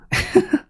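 a sudden squeaky giggle; high giggle. Real, not acted. Streamer caught their own drunk text, high giggle. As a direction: burst into a high little laugh.

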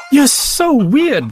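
slow, high sneering taunt; mocking. Farm chickens mock Daisy for wanting to fly, sneering. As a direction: tease her like a mean kid. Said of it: "they said".

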